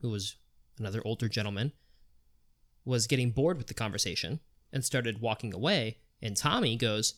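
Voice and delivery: ordinary delivery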